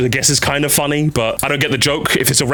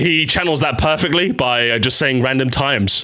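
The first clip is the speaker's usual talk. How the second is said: ordinary delivery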